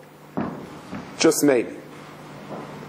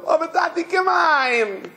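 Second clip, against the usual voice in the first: high, imploring imitation of a child; pleading little boy; 9 semitones higher, slower than usual. Boy begs his imprisoned father, pleading high. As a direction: cry it out high as a retold child's plea.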